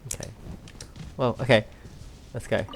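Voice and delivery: ordinary talk, nothing marked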